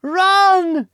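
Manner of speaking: a very high, loud cry; shouted warning. Acted. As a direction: yell to your friends to flee. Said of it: "shouted one word to them".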